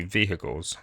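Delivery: American-style "vehicles" with a sounded h; mock American. Tutorial maker teasing American viewers, mock American pronunciation. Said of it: "taking the piss out of you Americans".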